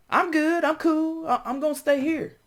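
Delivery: loud, very high put-on voice; friend declining. Friend turning down an invitation to chase dreams, high mock refusal.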